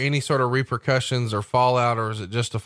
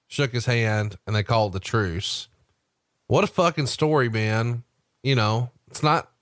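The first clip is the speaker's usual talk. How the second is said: ordinary talk, nothing marked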